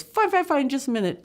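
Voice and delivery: high, hurried reassurance; a boy brushing off his uncle; high impatient reply. Retold or imitated.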